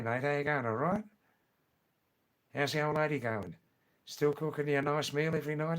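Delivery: quick, slurred Aussie workmate chat; broad Australian accent. Acted. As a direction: run words together like an Australian workmate. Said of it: "the other fifty percent talk like this".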